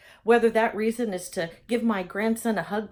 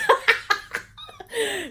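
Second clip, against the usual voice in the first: high delighted laugh falling away; happy laugh; 12 semitones higher than usual. Real, not acted. Vlogger cracking up after her whoop, high and delighted. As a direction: laugh with giddy joy.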